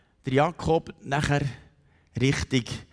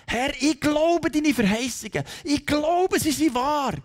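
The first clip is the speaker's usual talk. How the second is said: high, impassioned cry of faith; fervent declaration; 12 semitones higher, 3 dB louder, slower than usual. Acted. Believer telling God he trusts His promises, fervent. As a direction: declare it upward to God with full conviction.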